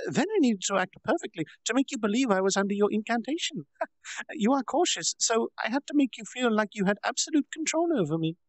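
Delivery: high, bright-voiced bragging girl; proud little girl explaining her trick; playful girl Milim. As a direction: gloat like a cheeky child. Milim's voice in story.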